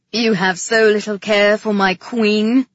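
loud, indignant question; accusing. A narrator's reading. King accusing his brother over the queen, accusing. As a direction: challenge him loudly.

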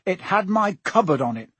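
put-on a boy's angry insistence. A narrator's reading. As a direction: argue back crossly. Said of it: "said Harry angrily".

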